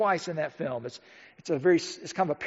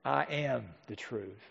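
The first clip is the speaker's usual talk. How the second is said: much softer and lower, slow; hushed solemn declaration; 7 semitones lower, 11 dB quieter, slower than usual. Retold or imitated. Preacher voicing Jesus' claim, soft and solemn. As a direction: drop to a reverent near-murmur. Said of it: "He doesn't say".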